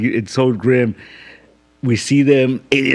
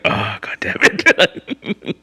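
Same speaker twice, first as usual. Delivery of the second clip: slow, drawn-out exasperation; slow exasperated sigh; 7 semitones higher, much slower than usual. Retold or imitated. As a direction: drag it out, fed up. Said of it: "he's like".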